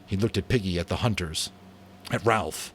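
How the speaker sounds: ordinary delivery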